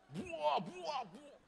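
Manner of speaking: high mock child crying buah; high childlike buah; child's voice. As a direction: squeal it like a greedy kid. Mimicking child grabbing fruit.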